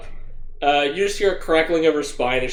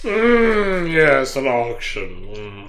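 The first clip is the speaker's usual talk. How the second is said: sinister drawl; 2 semitones lower, much slower than usual. Acted. Menacing echo, slowed.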